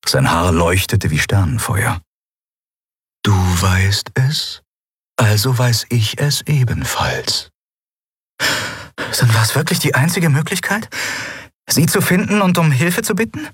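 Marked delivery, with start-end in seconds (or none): 3.2-7.5 very slow, deliberate spirit voice; measured spirit. A narrator's reading. The Eidolon explains what it knows, slow and measured. The Eidolon answers her question. 8.4-13.5 put-on high, earnest young woman's question; questioning heroine. A narrator's reading. Endriel asks the Eidolon for confirmation, high and earnest.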